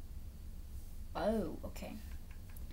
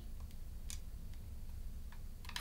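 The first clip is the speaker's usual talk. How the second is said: mock gasp; 18 dB quieter than usual. Falling into water in game.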